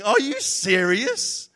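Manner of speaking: the preacher mocking his own disbelief at the medal claim; incredulous. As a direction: react like you can't believe your ears. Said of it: "I'm listening to this going".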